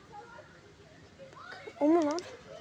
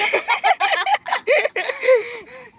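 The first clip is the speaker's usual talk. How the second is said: loud laugh; 8 semitones higher, 10 dB louder than usual. Real, not acted. Opening the vlog excitedly.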